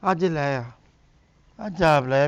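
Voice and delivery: soft, weak mock-invalid mumble; feigned sick voice. Husband feigning sickness. (ਨਾਟਕੀ ਬਿਮਾਰ ਆਵਾਜ਼)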